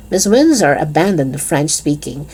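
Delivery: usual speaking voice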